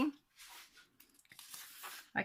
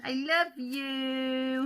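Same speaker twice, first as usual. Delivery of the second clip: sweetheart voice; 5 semitones higher, 5 dB louder, much slower than usual. Pretend. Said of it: "he looks like he's saying".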